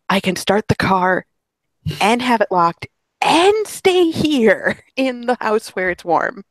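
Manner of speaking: excited retelling, climbing high and drawn out; mock delight. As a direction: act out amazed glee, breaking into a laugh. Acting out delight at remote starters.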